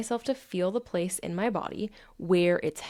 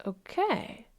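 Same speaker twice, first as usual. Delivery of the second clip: softer, high, drawn-out okay; voice of the emotion; 9 semitones higher, 5 dB quieter than usual. Acted. Emotion settling after the check-in, soft acceptance. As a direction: give in slowly and quietly. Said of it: "the emotion's like".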